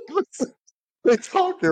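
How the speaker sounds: high-pitched laughing plea to listen; laughing through it. Real, not acted. Laughing to friends mid-story. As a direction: laughs while pushing on with the story.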